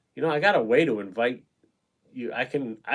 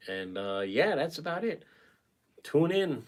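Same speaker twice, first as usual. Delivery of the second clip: ordinary delivery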